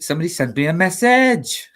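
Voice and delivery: high, lilting Welsh pantomime line; Welsh accent. Shown as a demonstration. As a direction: play it as a Welsh panto quote. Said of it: "'cause I accidentally did it".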